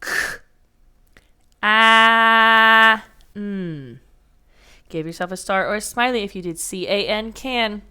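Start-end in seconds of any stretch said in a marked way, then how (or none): 1.6-4.0 teacher spelling out a word; sounding out. Shown as a demonstration. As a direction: stretch each sound of "can". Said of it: "I said".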